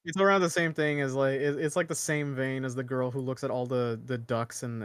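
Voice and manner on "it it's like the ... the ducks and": ordinary talk, nothing marked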